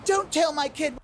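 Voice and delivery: high, offended snap at a stranger; mock indignant parent. Northern parent to stranger scolding their kid, mock indignant. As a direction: play a defensive parent bristling. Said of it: "you're like".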